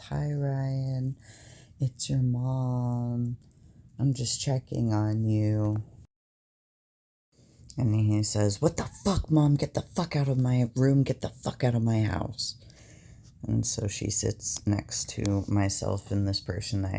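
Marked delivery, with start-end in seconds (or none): 0.1-5.8 imitating a mom's careful, drawn-out greeting; higher, stretched-out mom voice, easing down by the end; gentle checking-in mom. 8.6-12.6 mock outburst, starting high and falling; quick, high-pitched retelling of a furious rant; imitated angry outburst. Acted outburst at his mom.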